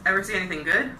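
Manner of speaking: ordinary delivery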